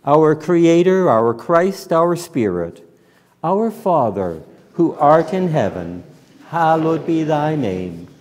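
congregational prayer. Real, not acted. Minister leading church in the Lord's Prayer, low measured praying.

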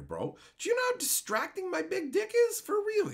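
high, outraged disbelief; incredulous ranting. Real, not acted. Streamer scoffing at chat's OnlyFans joke, high incredulous. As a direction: fire back at the chat way up high, scoffing. Indignant reply to chat joke.

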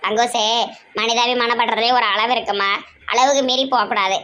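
low, weighty lecturing voice; deep-voiced elder sister. Elder sister warning Pankajam in a deep voice. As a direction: lay down the law from low in the chest.

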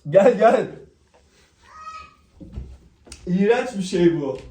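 loud, high, with candy in mouth; eating sour candy. Real, not acted. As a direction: shout through the sourness. (ağzında ekşi şekerle bağırarak)

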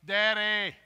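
put-on loud, high toddler yell for daddy. Grandpa imitating the toddler calling loud and high for daddy. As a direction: call out from the crib. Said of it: "then he starts".

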